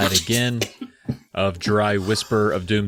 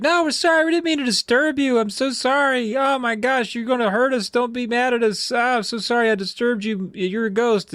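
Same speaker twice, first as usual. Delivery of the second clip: put-on pleading kids; 13 semitones higher than usual. Kids apologising to the ghost, high frightened pleading. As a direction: beg like scared little kids.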